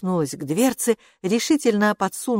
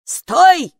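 loud, high cry of command; sudden urgent yell; shouting; 11 semitones higher, 10 dB louder than usual. A narrator's reading. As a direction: shout to halt someone. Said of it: "закричала привратница".

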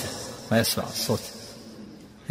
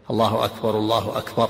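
"الله أكبر، الله أكبر" joined in one breath with no pause; imitating muezzins who rush the call; run together. Shown as a demonstration. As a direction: link both takbirs without stopping. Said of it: "مثل يفعل بعض المؤذنين".

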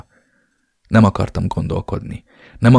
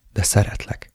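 breathy whispered confession; softly whispered love; 5 dB quieter than usual. Acted. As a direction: whisper it, almost no voice. Tender confession to lover.

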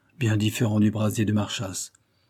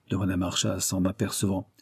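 usual speaking voice

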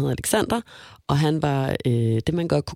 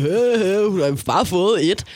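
put-on higher, louder dim-witted teen chuckle; mock dopey boyfriend; 4 semitones higher, 3 dB louder, slower than usual. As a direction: play the clueless boyfriend on the phone. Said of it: "og sådan".

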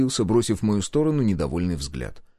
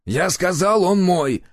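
higher, louder claim; angry insistence; 9 semitones higher, 4 dB louder, slower than usual. A narrator's reading. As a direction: insist angrily, no argument allowed. Hunter insists in the quarrel. (злая настойчивость)

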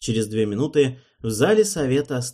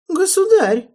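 a very high, loud cry of anguish; 11 dB louder than usual. A narrator's reading. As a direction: cry out in unbearable torment. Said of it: "воскликнул Холланд с нестерпимой мукой в голосе".

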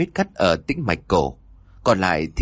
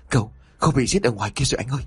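panicked; 4 semitones higher, much faster than usual. A narrator's reading. Frightened underling reports a killing.

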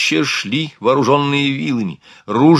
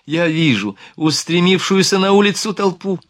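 higher, gladdened report; raised pitch, happy excitement; open joy; 6 semitones higher than usual. A narrator's reading. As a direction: announce the good news with delight. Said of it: "не скрывая радость в голосе".

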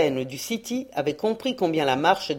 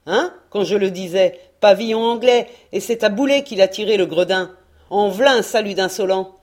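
put-on louder, outraged old sailor cursing the enemy; indignant sailor; 5 dB louder than usual. Sailor outraged at the English shot, loud and indignant. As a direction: spit out the insult at the English.

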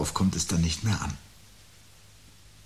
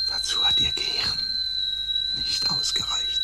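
whispering bitterly about the guilty. Acted.

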